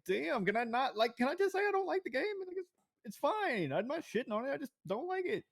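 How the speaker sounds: very high-pitched, pleading protest; exasperated protest. Retold or imitated. Player defending a dislike to fans, exasperated. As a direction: plead your case in a squeaky high voice.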